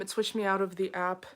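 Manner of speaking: normal talking voice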